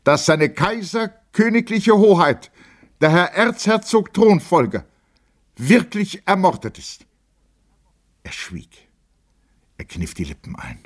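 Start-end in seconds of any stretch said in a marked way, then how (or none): 0.0-7.0 very high, strained public announcement; impassioned proclamation. A narrator's reading. Lieutenant tells the officers of the assassination, impassioned proclamation. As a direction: proclaim it phrase by phrase at high pitch.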